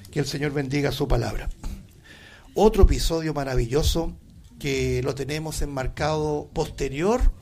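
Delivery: ordinary delivery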